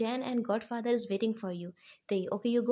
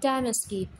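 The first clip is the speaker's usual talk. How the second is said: ordinary delivery, nothing marked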